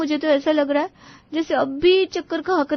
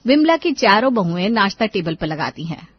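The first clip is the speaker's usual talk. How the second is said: story narration; 7 semitones lower than usual. A narrator's reading. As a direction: lower narrator voice, set the scene. Narrator voice between scenes.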